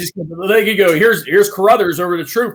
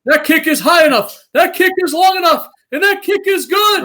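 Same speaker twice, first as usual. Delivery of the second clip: much higher, louder building field-goal call ending in a shout; Bill Hillgrove impression; 13 semitones higher, 5 dB louder than usual. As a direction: belt it out like a play-by-play man calling the kick. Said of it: "my old Bill Hillgrove impression".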